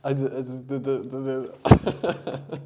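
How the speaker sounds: teasing imitation of a stammer; mocking stutter. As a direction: repeat the little words as if stuck. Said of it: "everyone just makes fun of it".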